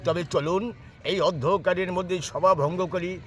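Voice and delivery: high, grand stage king; melodramatic folk theatre. Acted. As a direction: speak as a pleased jatra king. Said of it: "একটা যাত্রার dialogue দিচ্ছি".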